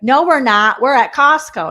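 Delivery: high, loud improv denial; loud contradiction. Acted. Acted improv partner contradicting the scene.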